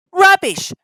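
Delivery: high, loud cross retort. A narrator's reading. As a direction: snap it crossly. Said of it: "said Thomas crossly".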